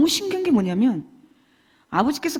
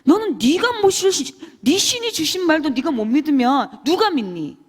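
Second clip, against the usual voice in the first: high, loud exasperated rebuke; angry scolding; 5 semitones higher, 5 dB louder than usual. Retold or imitated. Teacher scolding disciples who doubt, high exasperated rebuke. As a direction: snap at them in frustration. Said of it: "제가 그랬거든요".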